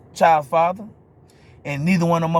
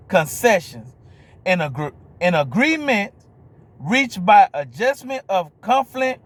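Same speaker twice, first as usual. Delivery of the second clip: dictionary read-out; 3 semitones higher, slower than usual. Real, not acted. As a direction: read it out phrase by phrase with big pauses. Repeats the dictionary definition.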